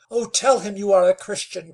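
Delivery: high, loud urgent plea; crying out. Acted. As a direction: beg him loudly. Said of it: "cried Nell".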